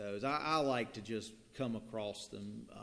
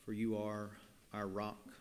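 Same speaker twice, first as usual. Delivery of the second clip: prayer; 3 semitones lower, 6 dB quieter than usual. Acted. Leads prayer.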